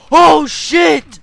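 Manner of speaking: high, very loud outburst; alarmed shout. Real, not acted. Player surprised mid-fight, alarmed shout. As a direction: yell in sudden alarm.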